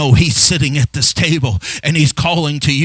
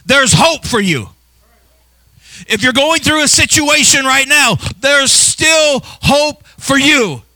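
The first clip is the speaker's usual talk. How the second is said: fervent sermon delivery; preaching at full force; 7 semitones higher than usual. Acted. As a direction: preach it out, build toward a shout. Preaching about hope, building.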